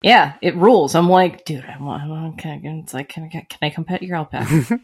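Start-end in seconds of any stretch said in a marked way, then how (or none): 1.4-4.8 hushed, eager little plea; soft pleading. Retold or imitated. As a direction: beg softly like a kid asking a favour. Begging friend to pet alpaca.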